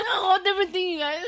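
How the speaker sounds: high, giggling through the words; laughing. Real, not acted. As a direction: talk while cracking up. Laughing at own joke.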